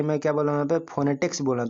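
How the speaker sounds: ordinary talk, nothing marked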